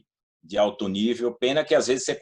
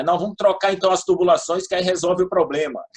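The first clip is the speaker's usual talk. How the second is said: lightly imitating people joking, higher and louder; retold joke, pitched up with a laugh after; 8 semitones higher, 5 dB louder than usual. Townspeople joking about swapping the water pipes, joking. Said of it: "o pessoal brincava".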